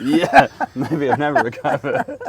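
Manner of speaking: says it through a laugh. Real, not acted. To Dale, joking about never recovering, laughing.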